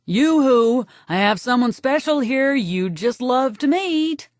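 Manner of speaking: a lightly voiced cheery yoo-hoo called down the hall; hint of a calling nun. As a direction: call out sweetly from afar. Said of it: "Sister Catherine shouts".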